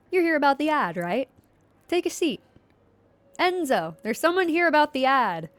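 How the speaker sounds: lively waitress voice calling to Enzo; Gianna's voice. Acted. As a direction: call the news through to the kitchen, bright and loud.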